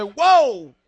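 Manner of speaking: loud startled "Whoa!". Retold or imitated. Preacher mimics a newcomer's startled reaction, loud. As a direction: react as if knocked back. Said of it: "you just say".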